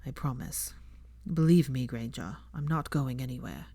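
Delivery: put-on low, hushed young man, gentle; lightly voiced young man, soft and reassuring; tender Draco. A narrator's reading.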